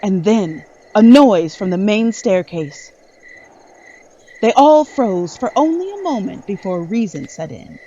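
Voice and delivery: loud, dramatic scene-setting narration; game master setting a scary scene; suspenseful narration. A narrator's reading. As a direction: build the dread as you tell it.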